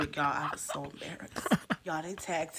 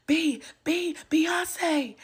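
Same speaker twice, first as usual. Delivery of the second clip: high, loud squeal of a fan losing it; starstruck fan squeal; 12 semitones higher, 7 dB louder, much slower than usual. Acted. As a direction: squeal her name like a superfan. Fan runs up screaming her name.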